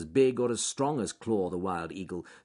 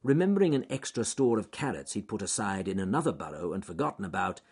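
usual speaking voice

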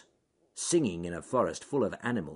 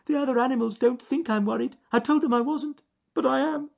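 very high, loud squeaky rabbit voice; worried little bunny, piercingly high; frightened rabbit; 8 dB louder than usual. A narrator's reading. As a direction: confess your fright in a tiny squealing voice. Frightened rabbit confesses his worry.

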